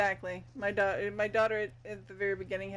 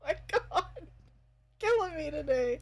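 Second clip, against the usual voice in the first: higher and louder, through laughter; laughing; 6 semitones higher, 4 dB louder than usual. Real, not acted. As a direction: say it while still cracking up. Laughing at joke.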